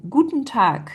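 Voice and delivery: "Guten Tag" ending in a K; final K sound. Shown as a demonstration. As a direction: close "Tag" with a K, not a G. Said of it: "on va prononcer K".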